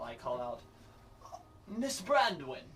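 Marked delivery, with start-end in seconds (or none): 1.7-2.6 high, loud pompous noble calling out; pompous Pudgemore. Acted. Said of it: "in the most pompous, um, Pudgemore voice possible".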